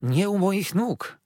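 high, loud, slow protest; dismayed plea. A narrator's reading. Husband begs his wife not to kneel. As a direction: lift her up with your voice. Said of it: "zawołał doktor".

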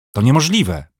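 high, loud cry of disbelief; shocked cry. A narrator's reading. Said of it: "krzyknął Pomian".

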